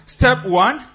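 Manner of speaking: loud, high barked count; crisp loud soldier report. Shown as a demonstration. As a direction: shout it like a drill sergeant. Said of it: "let's get it right".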